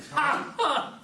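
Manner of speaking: loud gleeful laugh; hearty laugh. Acted. Tom laughs at Huck after scaring him, loud gleeful laugh. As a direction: laugh at the prank you just pulled.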